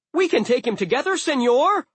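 fast, high, loud rallying call; rally your partner mid-fight; rapid high-pitched shout; excited battle cry. Acted. Sword rallies hero mid-fight.

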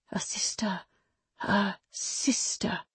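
unvoiced whisper, slowing on the repeat. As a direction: breathe it like a stunned thought. Raging thoughts repeated.

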